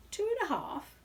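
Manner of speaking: high, disbelieving echo; astonished host. Acted. Host echoes the bear's answer, high and disbelieving. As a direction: repeat it, jaw dropping.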